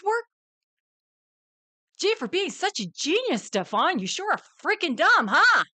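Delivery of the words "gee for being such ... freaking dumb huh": high, loud sarcastic jab; mock praise. Real, not acted. Mocking Stefan's intelligence, loud high sarcasm. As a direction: sneer it with fake admiration.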